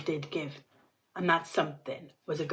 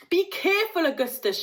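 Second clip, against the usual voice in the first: higher, louder warning call; father warning his son at the river's edge; shouted warning; 10 semitones higher, 4 dB louder than usual. A narrator's reading. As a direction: call out to the boy in alarm. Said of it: "shouted Mister Gloop".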